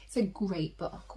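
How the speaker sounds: ordinary delivery, nothing marked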